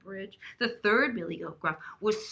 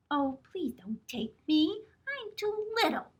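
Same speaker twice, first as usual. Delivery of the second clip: tiny goat voice, much higher; very small voice; 8 semitones higher than usual. Acted. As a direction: squeak it out like the littlest goat.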